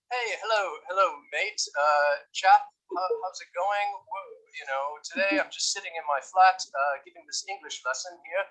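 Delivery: badly faked British accent; fake British accent. Shown as a demonstration. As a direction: put on a contrived, hammy British accent. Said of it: "that is a very bad British accent".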